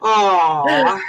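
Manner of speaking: high, wailing, laughing apology; hammed-up apology. Host begging forgiveness from the teary co-host, hammed-up wailing. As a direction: overdo the sorry to cheer her up. Comic over-the-top apology.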